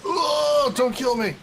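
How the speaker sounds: high, loud panicked cry; panicked plea. Real, not acted. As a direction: beg for your life in panic. Begging in game danger.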